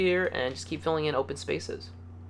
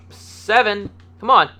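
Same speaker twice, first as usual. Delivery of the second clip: frustrated; 6 semitones higher, 11 dB louder, slower than usual. Real, not acted. Miscounts, then gets frustrated.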